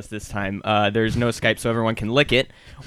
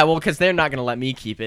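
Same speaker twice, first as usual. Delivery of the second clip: normal talking voice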